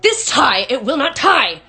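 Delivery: loud, high-pitched frustrated outburst; exasperated yell. A narrator's reading. Father yelling at a tie that will not tie. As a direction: rage at a stubborn object. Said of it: "He really yelled".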